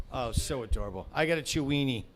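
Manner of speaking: ordinary delivery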